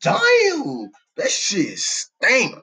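high cracking disgusted damn; disgusted squeal. Retold or imitated. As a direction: squeal at the smell. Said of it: "I'm like".

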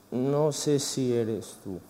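unsure aside. Real, not acted. Unsure whether he sees the man.

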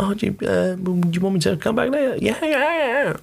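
very high, mock-panicked voices; flustered exchange. Housekeeper offering a panicked guest to return later, mock fluster.

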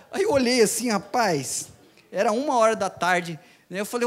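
ordinary talk

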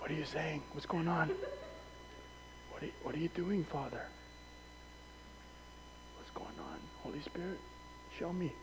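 soft, searching whisper to God; hushed prayer. Shown as a demonstration. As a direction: quietly ask the Father what he is doing. Demonstrating listening prayer.

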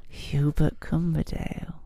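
slow creepy lilting name; Salad Fingers voice. Acted. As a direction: croon the name like a creepy puppet. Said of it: "inspired by salad fingers".

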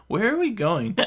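high, hiccupy tipsy question; hiccuping drunk. A narrator's reading. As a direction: ask it with a hiccup. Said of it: "she asks with a hiccup".

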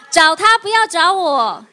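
playful pulpit emphasis; laughing emphatic repeat. Real, not acted. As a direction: drive home the line with a laugh. Pastor repeats the punchline, laughing.

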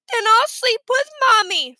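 put-on high, loud little-boy declaration; childish announcement. A narrator's reading. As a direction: announce it like a stubborn kid.

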